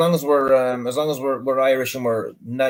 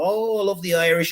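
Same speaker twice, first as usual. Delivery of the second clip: gushing woman; 7 semitones higher than usual. Retold or imitated. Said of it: "I said".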